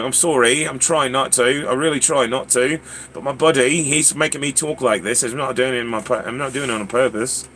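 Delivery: apologetic put-on British accent. Shopper blaming a buddy to the cashier, British accent. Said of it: "in a British accent where you can't even help it".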